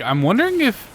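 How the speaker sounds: usual speaking voice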